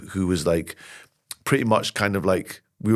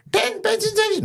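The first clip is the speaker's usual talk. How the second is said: put-on very high child's voice; wee boy Keenan. Boy asking the shopkeeper for cigarettes, squeaky and high. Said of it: "a wee boy Keenan comes in".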